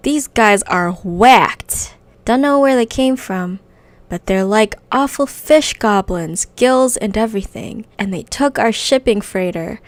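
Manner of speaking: loud, manic goblin demolitions guy; crazy goblin. Acted. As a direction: play him unhinged and loud. Reads a crazy goblin's lines.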